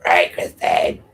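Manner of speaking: croaky put-on hoarseness; imitated hoarse croak; mock froggy voice. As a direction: mimic a frog caught in the throat. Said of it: "you ate a frog".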